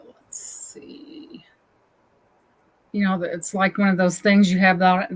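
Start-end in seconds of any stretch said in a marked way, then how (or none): 0.1-1.4 whispered to herself. Real, not acted. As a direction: mutter it under breath. Thinking aloud, stumped.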